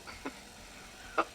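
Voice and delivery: a short soft scornful laugh; scoffing chuckle. Acted. Scientist scoffing at the Colonel's wish for weapons, soft chuckle. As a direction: scoff quietly at the small idea.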